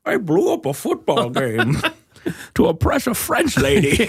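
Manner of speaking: hammed-up villain boast, breaking into laughter; mock-grand supervillain voice; Bane. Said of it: "Bane is my favorite".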